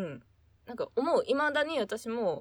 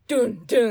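dramatic sound effect; 10 dB louder than usual. Retold or imitated. Mimics heartbeat dilemma sound effect.

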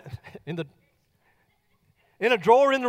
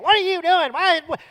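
scolding mother; 7 semitones higher, 4 dB louder than usual. Retold or imitated. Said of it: "she is just chewing Katie out".

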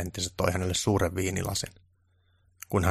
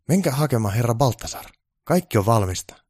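high, louder keen reply; eager to please; keen assistant voice; eager helper; 4 semitones higher, 5 dB louder than usual. Acted. Onni sends for director.

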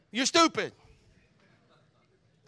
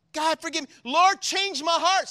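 prayer; 7 semitones higher, 6 dB louder than usual. Shown as a demonstration. Pastor modelling a prayer for change, louder and slowing. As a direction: cry out to God, drawing out change my heart. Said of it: "we need to pray like this".

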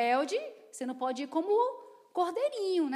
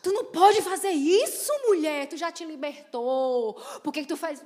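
self-scolding; 7 dB louder than usual. Retold or imitated. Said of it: "eu fui dentro do carro".

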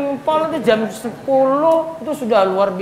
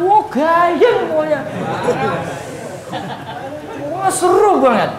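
gushing congregant; 4 semitones higher than usual. Acted. Preacher voicing a congregant raving about last night's study. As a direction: play the dazzled listener who loved it.

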